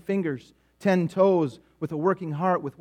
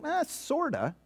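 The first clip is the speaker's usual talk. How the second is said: unsure answer; 9 semitones higher, 8 dB quieter, much slower than usual. Retold or imitated. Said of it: "Somebody say".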